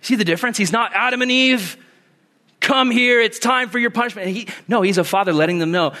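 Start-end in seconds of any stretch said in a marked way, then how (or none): 0.9-4.3 high, raised punishing voice; mock stern summons. A punishing God summoning Adam and Eve, mock stern. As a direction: call them in for punishment, pitched up.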